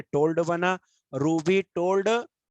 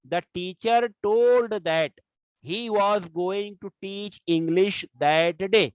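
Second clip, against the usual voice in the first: slow dictation; 3 semitones higher, much slower than usual. Shown as a demonstration. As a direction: read it out slowly like dictating to a class. Teacher reads example sentence aloud.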